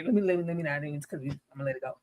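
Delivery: muttered restraint. Real, not acted. To the audience, softly reining herself in. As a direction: swallow the rant, drop it low.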